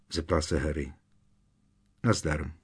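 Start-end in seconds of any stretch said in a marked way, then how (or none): 2.0-2.5 low, understated hello; curt, slightly lowered reply; offhand greeting. A narrator's reading. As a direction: toss the greeting out without interest. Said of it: "Utrousil místo odpovědi".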